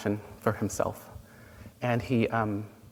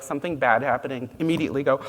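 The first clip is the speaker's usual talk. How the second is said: ordinary delivery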